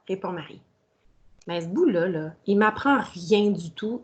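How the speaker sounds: ordinary delivery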